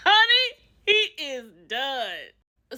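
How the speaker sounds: high burst then slow drawn-out verdict; laughing. Real, not acted. Host laughing at his meltdown, slowly. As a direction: crack up, then stretch it slowly.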